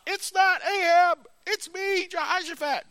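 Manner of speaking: very high, shrill-free squealing panic; screaming like a girl. Retold or imitated. Jehoshaphat panicking at the Syrian chasers, high screaming. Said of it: "He's screaming like a girl".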